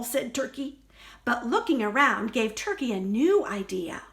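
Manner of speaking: ordinary delivery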